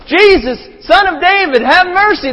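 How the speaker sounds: high, loud pleading shout; full-voiced beggar's yell for mercy; begging. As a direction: cry out desperately as the beggar. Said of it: "The beggar's begging".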